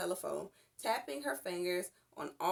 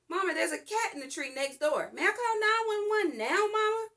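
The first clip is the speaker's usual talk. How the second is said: excited; 11 semitones higher, 7 dB louder than usual. A narrator's reading. Child running in, excited.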